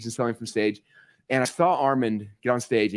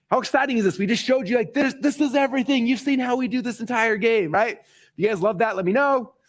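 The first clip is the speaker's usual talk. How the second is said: higher-pitched, eager rallying; excited hype; 9 semitones higher than usual. Real, not acted. Coach rallying students after showing the method, excited. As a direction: hype up the crowd about it.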